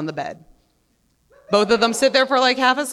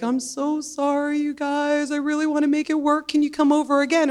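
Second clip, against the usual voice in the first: apologetic guy voice; 6 semitones higher than usual. Retold or imitated. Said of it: "messages my wife and is like".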